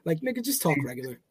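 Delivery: low, soft, quick aside in a lightly growled voice; mock reply to Batman. Telling Batman to drop the growl, lightly growled. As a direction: brush him off in a half-Batman rumble. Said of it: "I was like".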